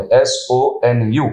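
very slow, letter by letter; spelling out. Real, not acted. Marketing head spells his name for viewers, slowly and deliberately. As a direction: spell it with each letter drawn apart.

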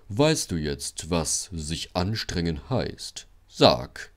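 low, slow, gloating question; smug teasing. A narrator's reading. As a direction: draw it out to rub the lesson in. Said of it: "kicherte Gordon".

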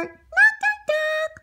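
high sing-song knocks; sung knock. Acted. Girl knocking on granny's door, sing-song. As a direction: knock it out in a little tune.

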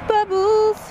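loud high delighted call; excited shout. Real, not acted. Mom playing bubbles with kid.

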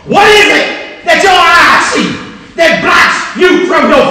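full-force gospel preaching; fiery preaching. Acted. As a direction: preach it out to the congregation. Preaching the Shamgar story.

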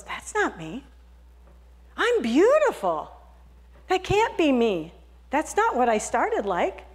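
high, slow wonder at beauty; amazed. Acted. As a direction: marvel at your reflection. Teacup sees itself.

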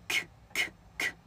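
k as bare bursts of air, no voice; unvoiced k, k, k with strong breath; aspirated k. Shown as a demonstration. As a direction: puff out k three times, spaced. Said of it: "什么叫送气".

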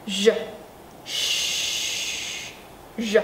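R buzzing from the throat; voiced R. Shown as a demonstration. Teacher drills the voiced R with the class. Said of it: "Cổ rung bật ra âm thanh nè".

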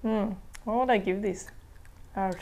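speaking between bites; chewing while talking. Real, not acted. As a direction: mouth partly full of snack. Seen 2: Hand at mouth biting snack, jaw working through early words.

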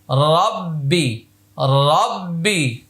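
রব্বি slowly with doubled ব held; slow recitation. Shown as a demonstration. Teacher modelling রব্বি slowly for students. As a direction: draw out রব্বি for learners. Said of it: "বা জের বি".

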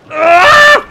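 loud, high, sudden yell; startled scream. Real, not acted. Player startled mid-game, screaming. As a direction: let out a short, piercing scream.

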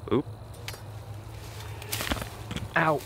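ordinary delivery

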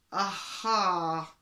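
loud, stern exclamation. A narrator's reading. Chief zookeeper catching out the old lady, sternly. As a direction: catch someone out with a firm exclamation. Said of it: "said the chief zookeeper sternly".

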